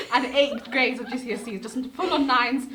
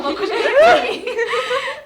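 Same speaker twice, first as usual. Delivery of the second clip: 1 laughing gasp; 11 semitones higher, 10 dB louder, much slower than usual. Retold or imitated. Retelling shocked discovery, laughing.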